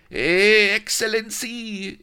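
very high, loud squeal of a toady; high shrieking courtier's call; eager servant cry. Acted. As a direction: yelp it out like a fawning servant. Servant calling to viceroy.

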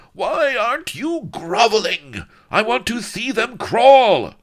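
high, loud shrieking scream; screaming tyrant. A narrator's reading. Viceroy screaming at the prisoners, high shrill scream. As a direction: shriek the order like a mad despot. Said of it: "the deadly sibilant voice rose to a scream".

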